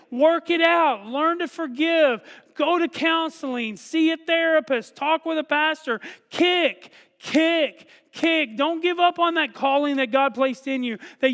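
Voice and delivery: high, loud impassioned preaching; preaching at full force. Acted. Pastor urging the church to persevere, preaching hard. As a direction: drive it home to the congregation.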